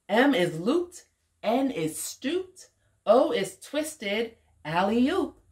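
lilting story voice with high, playful swoops; animated picture-book reading. A narrator's reading. Woman reading tumbled-letter story to a child, animated read-aloud. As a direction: read it bouncily, letting key words leap up.

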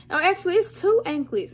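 surprised. Real, not acted. Sudden realization.

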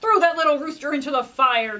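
loud angry command; king orders the rooster into the fire, angry. Acted. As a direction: bark the order at the servants. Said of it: "The king was angry".

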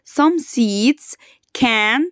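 very slow, word by word for the class; slow dictation pace. Shown as a demonstration. Teacher to grade four pupils, slowly for repeating. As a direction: spell the sentence out slowly so they can repeat. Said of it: "repeat after me".

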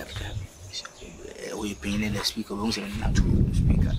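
hushed conspiratorial whisper. Acted. Telling a friend they won't tell the speaker, whispered. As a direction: keep it secret, just breath. Secret aside about the speaker.

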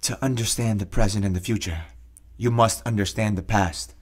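low, deep, solemn voice for the quote from his book; reading voice. Shown as a demonstration. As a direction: read it out like a grave pronouncement. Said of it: "So I'm gonna do my reading voice".

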